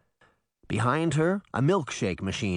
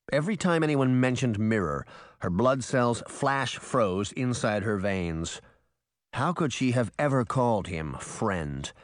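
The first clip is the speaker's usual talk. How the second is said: plain reading voice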